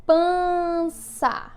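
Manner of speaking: PANÇA held long on each syllable; stretched pan-ça for pupils writing; dictation. Shown as a demonstration. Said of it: "eu vou ditar algumas palavrinhas".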